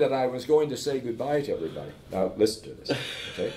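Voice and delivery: normal talking voice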